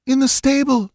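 high, groaning woman's voice. A narrator's reading. Settler woman groaning to Balthus, high. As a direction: groan it in distress. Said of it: "she groaned".

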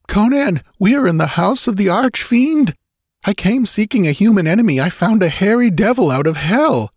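high, urgent voice of dread; horrified young nobleman. A narrator's reading. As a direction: warn a friend in a high, frightened voice. Murilo warns Conan in horror.

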